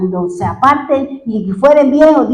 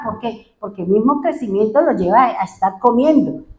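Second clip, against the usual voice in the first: ordinary delivery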